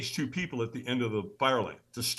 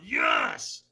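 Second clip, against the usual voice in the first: stronger yes; 13 semitones higher than usual. Retold or imitated. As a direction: answer the coach with full force.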